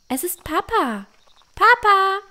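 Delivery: put-on a girl shouting joyfully to her father. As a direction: shout for her dad in delight. Anna sees her father coming.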